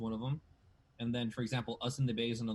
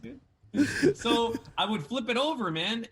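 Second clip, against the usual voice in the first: higher, louder, laughing through it; laughing excitedly; 10 semitones higher, 6 dB louder, slower than usual. Real, not acted. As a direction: blurt it out still laughing. Laughing excitedly about changing channel.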